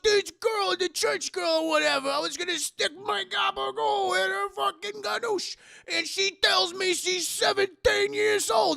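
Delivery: high, loud alarmed telling; alarmed shout. Retold or imitated. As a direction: blurt out the scandal in panic. Guy reports the shock.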